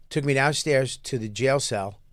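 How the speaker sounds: usual speaking voice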